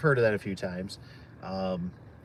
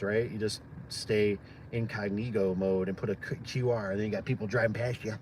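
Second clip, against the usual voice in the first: usual speaking voice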